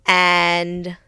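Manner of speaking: ordinary talk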